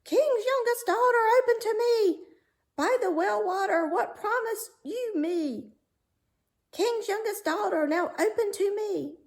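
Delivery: high, loud croaky frog calling; a frog singing out at the door. Acted. Frog at the door calling the princess, high and loud.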